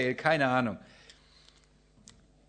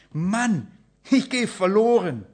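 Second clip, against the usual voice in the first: higher, louder panicked cry; 6 semitones higher, 7 dB louder, slower than usual. Acted. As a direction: act out the sudden terror of being lost.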